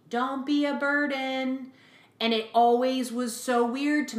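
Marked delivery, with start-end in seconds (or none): 0.1-1.7 higher, slow mock call from mom; mom yelling. Mother calling after her daughter, a mild mock yell. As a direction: call it out like a mother across the house. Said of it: "my mom would yell to me".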